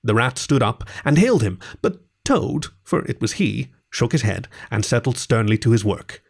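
ordinary delivery

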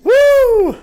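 high, loud whoop; cheering whoop. Real, not acted. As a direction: whoop for a friend. Cheering a friend's album plug.

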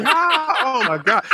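high-pitched fanboy gasp, retold; mock amazement. Teasing a friend about his music knowledge, mock amazement. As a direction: play up being blown away.

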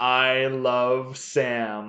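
very slow, drawn-out line to repeat; slow dictation. Acted. Son feeding dad the words to say, slow dictation. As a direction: spell it out slowly, word by word. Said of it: "I just need you to say".

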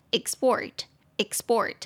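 "export" stressed on PORT; stress on second syllable. Shown as a demonstration. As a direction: say "export" slowly as ik-SPORT. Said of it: "把重音节放到第二音节".

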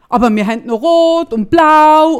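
mimicked saleswoman. Retelling the saleswoman's reply.

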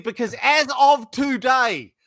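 put-on venting at the old provider; mock anger.

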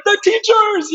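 a high, joyful cry of recognition; leaping up with a high shout of the teachers; excited cry. Retold or imitated. Jumping up as the doctor names the teachers, a high excited cry.

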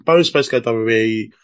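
normal talking voice

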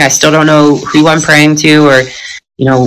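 ordinary talk, nothing marked

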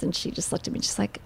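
unvoiced whisper of an aside; hushed aside. Real, not acted. Telling a friend about the doctor, in a whisper. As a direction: drop to a breathy whisper mid-story.

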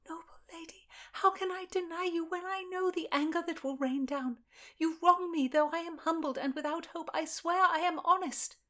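put-on a young lady in service, desperate and pleading; frightened young lady pleading. Constance voiced again.

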